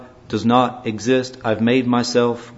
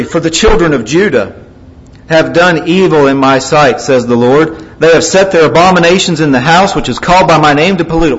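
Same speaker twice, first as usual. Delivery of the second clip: loud, raised pulpit reading; forceful scripture reading; 4 semitones higher, 7 dB louder than usual. Real, not acted. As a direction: proclaim the verse with force. Reading scripture aloud to congregation.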